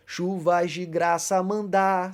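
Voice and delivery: very slow, drawn-out reading of the hymn verse; slow hymn line. Shown as a demonstration. Teacher quoting a hymn verse to the class, slowly drawn out. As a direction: stretch each word of the hymn line. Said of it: "segundo verso".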